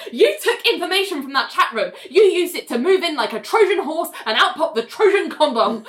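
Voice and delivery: high-pitched mock cross-examination rant; acted tirade. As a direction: press on over the protests, amused. Lawyer keeps going over objections.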